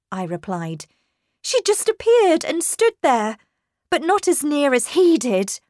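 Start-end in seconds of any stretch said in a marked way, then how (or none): 1.4-5.6 high, louder, urgent report; agitated account. A narrator's reading. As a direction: describe it still alarmed. Governess urgently describes apparition.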